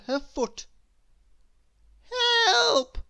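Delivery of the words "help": much higher, louder call for help; cry for help. Acted. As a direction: yell for help from inside a bucket. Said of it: "came a voice from inside the bucket".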